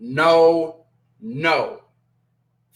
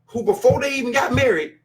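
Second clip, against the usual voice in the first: normal talking voice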